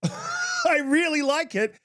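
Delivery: a real amused laugh as you say it. Real, not acted. Rant, laughs at accusation.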